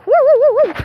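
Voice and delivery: high, loud hooting woo woo; bird call imitation. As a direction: mimic the odd bird's hoot. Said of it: "a bird going".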